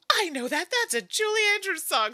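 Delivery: high, loud burst of delight; gushing, high-pitched retold excitement; excited manager. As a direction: imitate someone thrilled to recognise a song. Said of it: "She was so excited".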